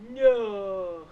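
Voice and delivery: loud, dragged-out northern vowel; broad dragged Yorkshire. Shown as a demonstration. Contrasting own speech with dad's, loud broad Yorkshire vowels. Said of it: "Dragging my vowels for as long as humanly possible".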